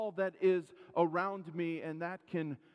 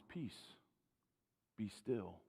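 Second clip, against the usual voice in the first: much softer, lower, hushed command; calm and peaceful; 8 semitones lower, 9 dB quieter, slower than usual. Acted. Jesus calming the storm for frightened disciples, softly. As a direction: still the storm with quiet calm. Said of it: "probably sounded calm and peaceful".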